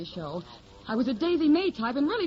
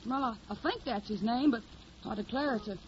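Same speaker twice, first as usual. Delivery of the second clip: put-on hillbilly accent; 3 dB quieter than usual. Hildy poses as a Tennessee country girl, in a put-on Southern accent. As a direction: play the naive Southern hillbilly singer. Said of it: "I got the technique down pat".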